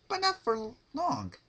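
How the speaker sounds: high, sing-song sweet menace; bright cheery threat, voiced high; Pinkie Pie voice. Narrator gives Pinkie a high sweet creepy voice.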